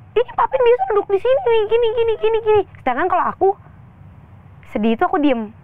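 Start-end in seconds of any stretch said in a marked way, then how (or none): 0.1-2.6 much higher, slightly louder complaining brother. Retold or imitated. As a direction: mimic the little brother whining about dad's seat. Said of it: "mengeluh yang kayak". (meniru adik yang mengeluh dengan suara jauh lebih tinggi)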